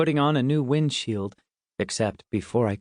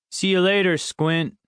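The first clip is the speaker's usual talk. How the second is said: high, called-out schoolboy farewell; taunting singsong send-off; teasing goodbye; 7 semitones higher than usual. A narrator's reading. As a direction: toss the nickname back in a high voice. Teasing boy calls out a goodbye.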